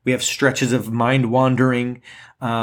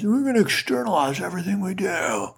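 put-on old-fashioned professor; 5 semitones higher, 3 dB quieter than usual. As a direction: lecture like a fusty old professor. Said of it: "This is my old-fashioned professor voice".

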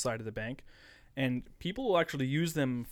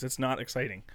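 ordinary talk, nothing marked

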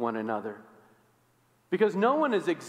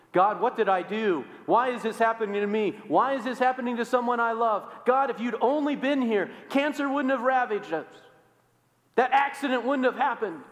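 anguished accusation; 7 semitones higher than usual. Acted. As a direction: throw the why at God in raw grief. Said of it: "Often trying to think about guilt".

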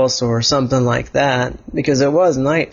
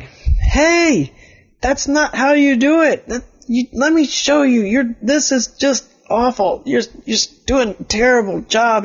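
much higher, exasperated telling off; frustrated scolding; 10 semitones higher than usual. Acted. Elliot scolding the clueless player, high exasperated voice. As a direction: scold him for doing it wrong. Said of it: "making Elliot frustrated".

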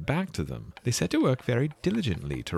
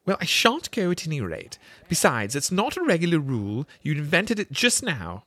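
indignant; 10 semitones higher than usual. A narrator's reading. Alice protests.

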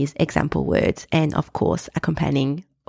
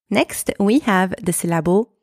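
normal talking voice

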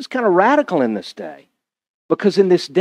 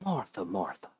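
tender; 10 semitones lower, 19 dB quieter than usual. Acted. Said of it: "Jesus tenderly responds to her".

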